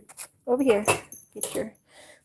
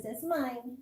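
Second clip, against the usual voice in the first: softer possessive dog voice; voicing the dog; 9 dB quieter than usual. Owner voicing her dog claiming food, softer. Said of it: "She says".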